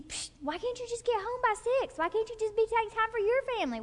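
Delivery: high, fast, exasperated snap; snapping wife. Acted. Wife snapping at husband about coming home late, high and fast. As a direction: nag him like a fed-up wife, quick and high. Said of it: "let's say she's snapping at him".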